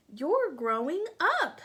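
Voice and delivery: mildly voiced worms. Worms telling the acorn it is growing. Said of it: "said the worms".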